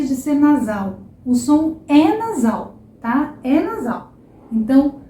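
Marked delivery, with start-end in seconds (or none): none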